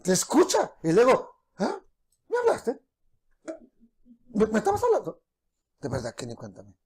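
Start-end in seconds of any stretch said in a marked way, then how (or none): 1.6-5.2 much higher, soft and puzzled; teen daughter looking up from her phone, surprised; distracted surprised daughter. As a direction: play the startled absent-minded kid.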